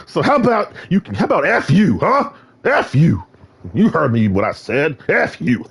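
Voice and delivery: laughing taunt; mocking taunt. Real, not acted. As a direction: jeer at the chat playfully. Taunting chat with F you.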